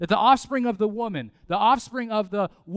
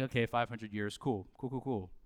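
unimpressed shrug; 9 semitones lower, 11 dB quieter than usual. Retold or imitated. Listeners shrug off long promise.

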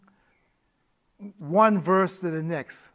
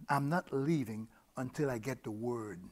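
deep inner voice; 6 semitones lower, 12 dB quieter than usual. Retold or imitated. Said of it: "out of the depths of this man came the words".

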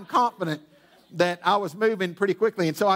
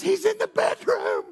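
frantic wife; 14 semitones higher than usual. Retold or imitated. Wife answers in the panic.

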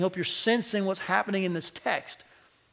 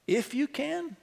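higher, softer incredulous repeat; incredulous echo; 6 semitones higher, 5 dB quieter, slower than usual. Acted. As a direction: throw the words back in disbelief. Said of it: "the tenor of that phrase".

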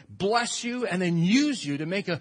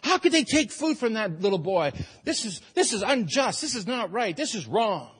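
mock outrage, higher and louder; indignant crowd; 5 semitones higher, 3 dB louder than usual. Crowd protesting Jesus takes boy's food, indignant. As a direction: protest the injustice. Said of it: "You can hear that".

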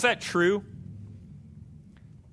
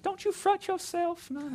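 put-on genteel Southern old lady; 11 semitones higher, 6 dB quieter than usual. Preacher imitating a genteel Southern lady, soft and high. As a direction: lilt "fret" gently like a genteel Southern granny. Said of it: "like kind of a genteel Southern old lady".

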